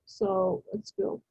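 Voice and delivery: quick unvoiced whisper; hushed aside. Real, not acted. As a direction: whisper it off-mic to the helper. Aside to slide operator, whispered.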